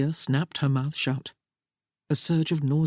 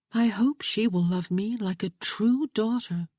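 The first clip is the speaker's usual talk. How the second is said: a higher, lightly voiced young woman, earnest and pleading; young bride Sophia; 6 semitones higher than usual. A narrator's reading. Bride reassuring her new husband, raised lighter voice. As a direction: play the bride gently begging James to understand.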